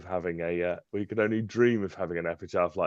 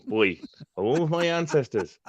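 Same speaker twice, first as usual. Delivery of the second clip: hammed-up Welsh accent on "boy, home of my ancestors"; lilting mock Welsh, proud of "ancestors"; 4 semitones higher than usual. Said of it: "love that Welsh accent".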